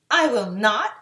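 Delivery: loud firm refusal; brave. A narrator's reading. Lion refuses the witch, brave and loud. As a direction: stand up to her bravely. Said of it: "said it in a very brave way".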